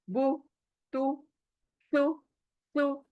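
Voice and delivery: ordinary delivery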